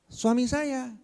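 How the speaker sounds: a hint of a complaining wife; wife's voice. Wife tells the teacher who is angry, in a high voice.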